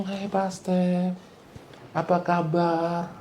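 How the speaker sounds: mock-polite, soft, slow greeting. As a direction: sweetly greet the pastor. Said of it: "sopan loh". (sapaan sopan yang dibuat-buat, pelan dan lembut)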